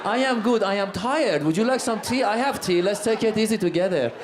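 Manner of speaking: put-on laid-back, drowsy Iranian accent; sleepy Iranian accent. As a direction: take your time, in a lazy Iranian accent. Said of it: "we're falling asleep".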